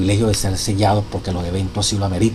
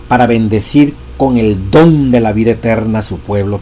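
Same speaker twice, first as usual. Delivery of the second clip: loud, solemn reading of a sacred text; preacher reading scripture with force; reading a quotation aloud; 7 dB louder than usual. Acted. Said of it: "Oigan esto".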